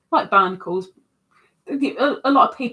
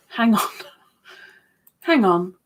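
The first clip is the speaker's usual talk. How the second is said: usual speaking voice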